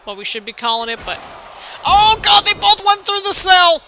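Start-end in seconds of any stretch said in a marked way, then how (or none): 1.9-3.8 high, loud shout of disbelief; real shock, much higher and louder; stunned shout. Real, not acted. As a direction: yell it out as they smash through. Wrestlers crash through cage roof.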